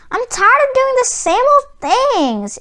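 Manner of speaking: high grumbling complaint. A narrator's reading. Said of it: "it grumbled".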